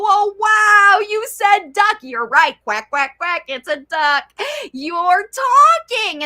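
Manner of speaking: high, loud, delighted praise; excited parent praise. Retold or imitated. Parent praising toddler for saying duck, high and loud delight. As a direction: cheer the toddler on like a thrilled parent. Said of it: "And then you go".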